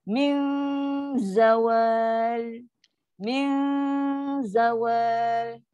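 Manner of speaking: "Min" held on a light hum into "zawal"; slow "Min zawal" with thin z; thin low dengung. Shown as a demonstration. Teacher demonstrates the low hum before zai, thin dengung. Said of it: "dengung ni pun dengung yang taf, ha, tarkib je lah".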